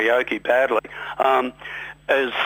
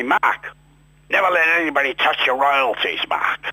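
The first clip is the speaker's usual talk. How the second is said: a light, hammed-up Scottish accent as George; imitating George's Scottish advice in a mock Scottish accent; bad Scottish accent; 3 semitones higher than usual. George advising Mark on royalties, light mock Scottish accent. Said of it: "I'll do the bad Scottish accent".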